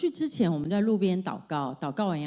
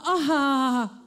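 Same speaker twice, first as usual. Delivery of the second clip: giving in; 6 semitones higher, 5 dB louder than usual. Retold or imitated. Mother gives in to daughter.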